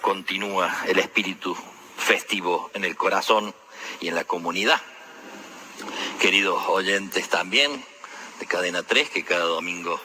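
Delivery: upbeat homily opening address; warm radio greeting. Acted. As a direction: greet the Sunday radio listeners with full voice.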